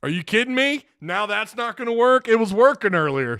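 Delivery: high, frustrated disbelief; real annoyance at the broken stream; exasperated outburst. Real, not acted. Stream alert failed, exasperated outburst.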